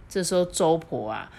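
ordinary delivery

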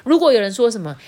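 ordinary delivery, nothing marked

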